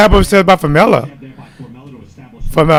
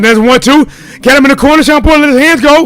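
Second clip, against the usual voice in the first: excited; 9 semitones higher, 4 dB louder than usual. Real, not acted. Excited live fight call.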